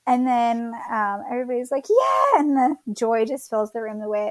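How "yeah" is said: high, louder burst of joy; retold cheer. Mother voicing the room's relief at the birth, cheering. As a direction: imitate a whole room cheering.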